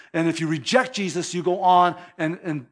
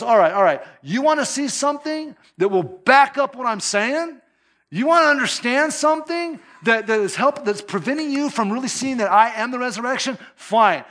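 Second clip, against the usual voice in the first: loud, high, fed-up Jesus giving in; all right, fine, you want proof, say it with a raised voice; exasperated Jesus; 6 semitones higher, 4 dB louder than usual. Retold or imitated. Jesus, fed up with their unbelief, says it loudly and high. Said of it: "And he goes".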